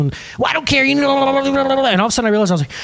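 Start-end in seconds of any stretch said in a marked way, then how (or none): 0.4-1.9 high, run-together mock tirade; ranting boss. Manager dismisses employee's excuse, babbling mock rant.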